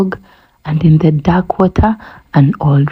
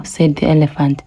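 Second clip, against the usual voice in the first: ordinary delivery, nothing marked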